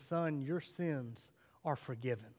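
preacher giving Jesus' true words to the paralytic, softly; soft correct line. Acted. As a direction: say it quietly, like a revelation. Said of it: "He looks at them and says".

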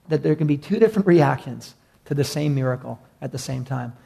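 ordinary talk, nothing marked

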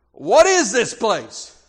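loud, drawn-out bewildered bellow; booming demand. Retold or imitated. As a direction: boom it out like a big intruder. Big intruder confronts the church ushers.

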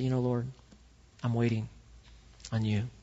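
soft, low, patient prayer; hushed trusting prayer; quiet prayer. Shown as a demonstration. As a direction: speak gently to God, waiting.

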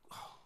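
soft, breathy acted sigh; dejected sigh. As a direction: let out a deflated "oh". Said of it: "And I'm thinking".

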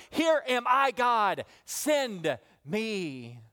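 high, stretched, building proclamation; impassioned preaching. Real, not acted. Preacher voicing Isaiah changed by God, impassioned. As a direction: declare it with full conviction, easing on the last words.

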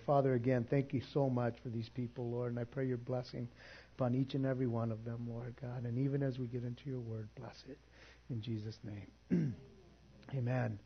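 soft, hushed prayer voice. Acted. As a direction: pray quietly over the congregation. Closing prayer.

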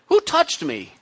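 high, puzzled "Who touched me?"; puzzled Jesus. Retold or imitated. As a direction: ask it up high, searching. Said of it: "And he's like".

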